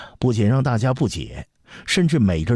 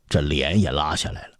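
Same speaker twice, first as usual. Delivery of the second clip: plain reading voice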